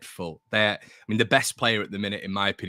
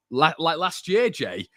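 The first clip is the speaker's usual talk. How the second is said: higher and slowed, sarcastic; mocking retort; 7 semitones higher, slower than usual. Real, not acted. Host mocks a fan who mentioned last year, sarcastic and drawn out. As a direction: drag it out to mock the comment.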